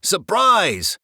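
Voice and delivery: loud hollered surprise; hollering. A narrator's reading. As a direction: holler it like a band. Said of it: "hollered Scat Cat and the band".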